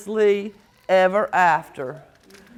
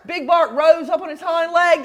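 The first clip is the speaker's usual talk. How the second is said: usual speaking voice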